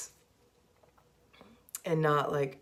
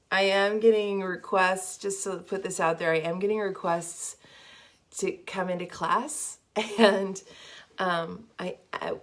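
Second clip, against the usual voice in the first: ordinary talk, nothing marked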